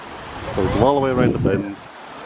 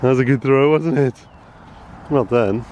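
usual speaking voice

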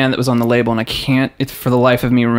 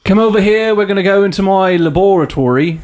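higher-pitched put-on British accent; English accent; 5 semitones higher than usual. Friend showing the English way, hammed-up British accent. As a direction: play it English, with "la-BOR-a-tory". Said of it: "say it in the English way".

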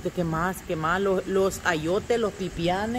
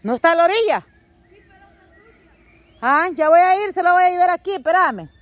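voz alta y aguda para alguien que está lejos; llamando a gritos; 9 semitones higher, 5 dB louder than usual. Real, not acted. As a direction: grita para que te oiga desde allá. Responde a gritos a Diego lejano. (llamando a gritos, de lejos)

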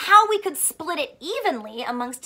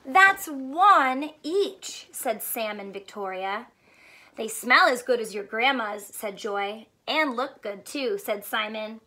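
lively picture book reading; story read to children; 4 semitones lower than usual. Acted. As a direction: read it like storytime for little ones. Reads picture book aloud.

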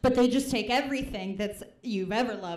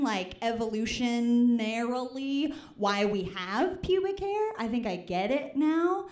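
ordinary talk

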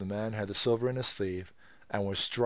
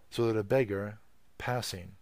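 usual speaking voice

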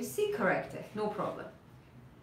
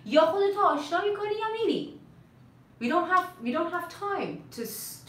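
a higher-pitched warning to a new hire; lightly voiced stern employer; 7 semitones higher than usual. Company warns new employee to learn the system, mildly stern employer. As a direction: give a touch of an impatient boss's ultimatum.

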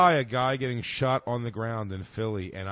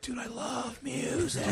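stoned dude; 11 semitones higher, 7 dB quieter, slower than usual. Retold or imitated. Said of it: "my favorite stoned energy".

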